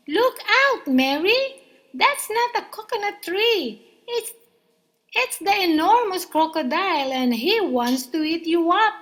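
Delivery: high, loud urgent yell; shouting. A narrator's reading. Hippo shouting at the children about the crocodile. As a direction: scream it to save them. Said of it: "shouted Humpy Rumpy".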